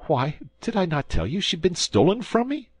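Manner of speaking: high, indignant frightened protest; protesting prisoner. A narrator's reading. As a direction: squeak out the protest in alarm. Bonacieux protesting.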